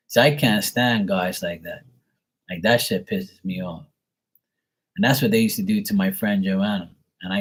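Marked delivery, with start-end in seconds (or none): none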